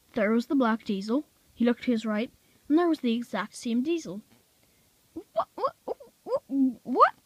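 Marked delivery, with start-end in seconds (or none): 5.1-7.1 high, stammering disbelief; baffled. A narrator's reading. Bill seeing two identical diesels, baffled and high. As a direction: stutter out a confused high what.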